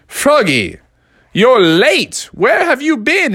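demanding, climbing high on the question; scolding teacher. Acted. Teacher to late Froggy, scolding. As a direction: tick off a late pupil.

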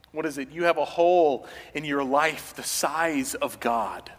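usual speaking voice

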